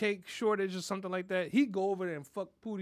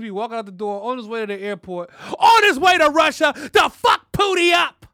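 rising into a loud, high shout; comic build about grandpa heading to Russia; building to a yell; 7 semitones higher, 15 dB louder than usual. Acted. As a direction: climb to a hammed-up roar.

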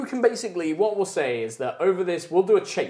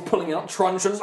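normal talking voice

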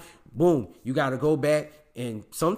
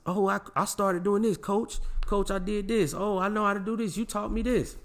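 put-on higher, eager kid voice; mock eager kids; 5 semitones higher than usual. Imitating his kids bragging to their coach, eager and higher. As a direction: play the proud kid reporting to coach.

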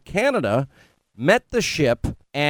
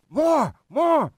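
put-on high-pitched kid begging for more; imitating eager son; 10 semitones higher than usual. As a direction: mimic your little boy demanding more. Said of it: "I couldn't thrash my son enough".

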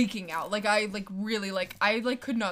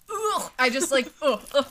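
disgusted groan; 4 semitones higher, 6 dB louder than usual. Real, not acted. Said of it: "it's so gross".

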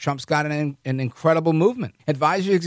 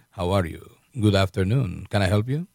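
smooth ladies' man; 10 semitones lower than usual. Retold or imitated. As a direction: play a charming old man greeting a lady. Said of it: "put that, that grin on his face and was like".

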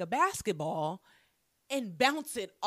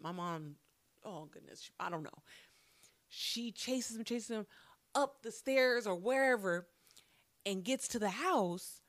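usual speaking voice

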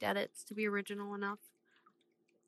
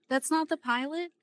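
surprised; 8 semitones higher, 5 dB louder than usual. Real, not acted. Surprised question.

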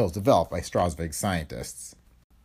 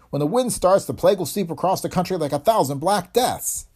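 high, raised-voice orders; urgent yelling; 6 semitones higher, 3 dB louder than usual. A narrator's reading. Professor sending helper to phone for help, urgent yelled orders. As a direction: call out emergency instructions with mounting alarm. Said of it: "he yelled".

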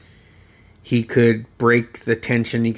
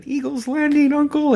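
put-on much higher, childlike chirp; little girl voice. Little Carly squeezing back at her uncle, high and childlike. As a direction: play the tiny cousin teasing her uncle. Tiny cousin imitating uncle.

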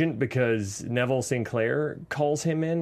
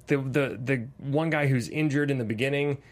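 usual speaking voice

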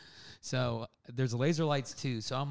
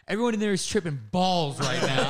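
louder, laughing reveal; laughing through it; 5 semitones higher, 7 dB louder than usual. Retold or imitated. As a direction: blurt it out while cracking up. Zach reveals the acid party.

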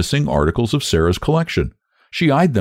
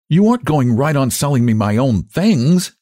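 aghast, higher-pitched disbelief; mildly gasping incredulity; gasped; 7 semitones higher, 3 dB louder than usual. A narrator's reading. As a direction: protest it high, aghast. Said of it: "gasped Sarah".

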